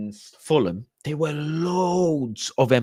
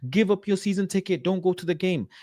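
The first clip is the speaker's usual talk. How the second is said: higher mocking quote, accent dropped; 8 semitones higher than usual. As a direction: repeat it mockingly up high without the put-on accent. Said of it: "why did I even do that accent?".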